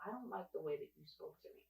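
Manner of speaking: restrained, soft workplace voice; careful calm voice. Shown as a demonstration. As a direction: say it softly and evenly so nobody can call it angry. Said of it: "me making sure that my voice is fine".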